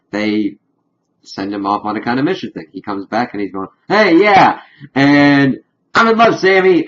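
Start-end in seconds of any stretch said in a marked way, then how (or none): 3.9-6.9 giddy imitation, higher and louder; lovestruck Dean. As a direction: mimic him dopey and smitten. Dean retold while under a spell.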